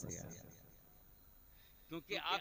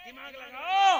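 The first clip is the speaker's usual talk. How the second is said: much higher and far louder, a sudden burst; shouted jab; 10 semitones higher, 19 dB louder, slower than usual. Real, not acted. Preacher shouting at a listener to use his brain. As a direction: bark it at the man to wake him up.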